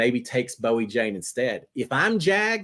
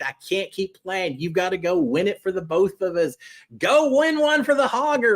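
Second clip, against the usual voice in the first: mock-heroic pep talk, pitched up; hammed-up injured hero plea; 6 semitones higher than usual. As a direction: ham it up like a fallen soldier begging her to carry on. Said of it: "So I'm sitting there saying".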